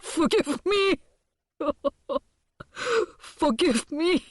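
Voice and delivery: high, slow, trembling plea; quivering voice. A narrator's reading. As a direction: beg for mercy, voice shaking. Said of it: "says in a quivering voice".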